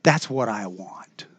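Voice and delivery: soft unvoiced confession; whisper. Real, not acted. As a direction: breathe it almost silently. Whispered personal longing.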